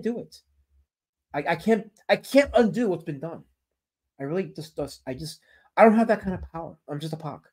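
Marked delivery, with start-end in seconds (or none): none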